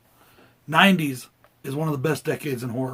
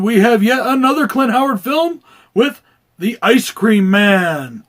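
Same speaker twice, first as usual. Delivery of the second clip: ring announcer; 6 semitones higher, 9 dB louder than usual. Acted. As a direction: hype it like a boxing announcer. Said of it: "Is this voice getting annoying yet?".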